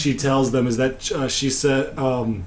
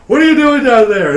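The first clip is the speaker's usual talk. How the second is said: high, loud challenge; raised-voice acted interrogation; demanding guard; 10 semitones higher, 7 dB louder than usual. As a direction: question them like an alarmed guard. Guard questioning the intruder, retold.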